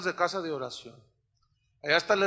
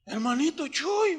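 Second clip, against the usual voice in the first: voicing Pedrito; 8 semitones higher than usual. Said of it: "Un día me dice".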